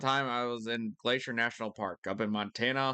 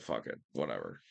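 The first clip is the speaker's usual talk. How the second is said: giving up; 14 semitones lower, 6 dB quieter than usual. Retold or imitated. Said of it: "I was just like".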